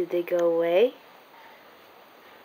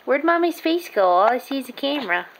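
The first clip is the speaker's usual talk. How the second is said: ordinary delivery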